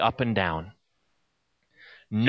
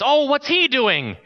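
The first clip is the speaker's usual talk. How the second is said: put-on higher, slow child's question; a small boy pointing, curious; curious little boy; 10 semitones higher, 4 dB louder, slower than usual. Little boy asks about sweeper.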